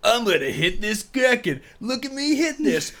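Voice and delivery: high, hammed-up bully voice; overacted anime dub. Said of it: "the dub voice of".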